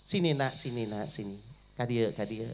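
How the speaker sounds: soft, low, tender coaxing; dying father softly calling his daughter near. Acted. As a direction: draw the child close gently.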